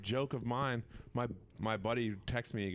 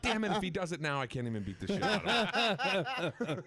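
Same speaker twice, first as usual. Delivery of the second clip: high-pitched comic frustration; mock exasperation; 8 semitones higher than usual. Comic laments losing his chance for revenge, comically exasperated. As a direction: complain in a raised, hammed-up voice. Thwarted threat, retold for laughs.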